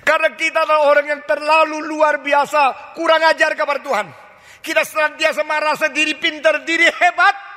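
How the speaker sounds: high, loud preaching with rising force; preacher at full force; fiery preaching. Acted. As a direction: hammer the rebuke home to the congregation.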